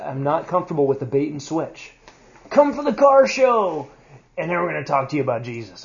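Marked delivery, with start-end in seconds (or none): 2.5-5.8 salesy pitch voice. Retold or imitated. Mocking church's bait pitch.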